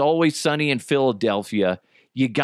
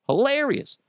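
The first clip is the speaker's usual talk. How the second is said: HILARIOUS pitched way up, then falling; high-pitched emphasis; 12 semitones higher than usual. Real, not acted. Host repeating his verdict on Danny DeVito, high-pitched "Hilarious".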